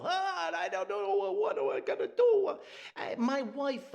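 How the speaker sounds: high, frantic Italian neighbor voice; Italian accent. Retold or imitated. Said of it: "the Italian thing".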